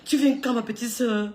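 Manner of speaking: usual speaking voice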